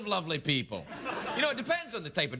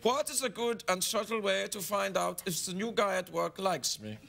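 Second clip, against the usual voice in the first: put-on comic German accent. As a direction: read the letter in a mock German accent. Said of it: "read them in my German accent".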